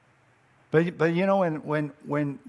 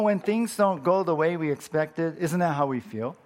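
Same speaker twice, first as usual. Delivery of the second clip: ordinary talk, nothing marked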